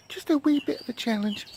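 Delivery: high, soft put-on British accent; mock British accent, tiny voice.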